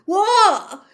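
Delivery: loud jolted cry of fright; startled yelp. A narrator's reading. Ron startled by Fang's bark, loud yelp. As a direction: jump out of your skin and yelp. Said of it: "said Ron loudly".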